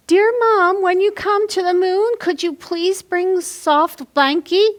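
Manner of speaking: a hint of a small child asking nicely; little girl's voice. Teacher reads Sophia's letter to Mom, light little girl voice.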